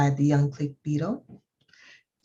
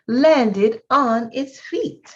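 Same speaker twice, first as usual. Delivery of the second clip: triumphant; 5 semitones higher, 5 dB louder, slower than usual. A narrator's reading. Triumphant story climax.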